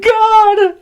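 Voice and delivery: high wailing cry of God, lightly mocked; mock the crying man's sob; imitating crying guy. Retelling a meme video to friends, mock crying wail. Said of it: "it's a guy crying".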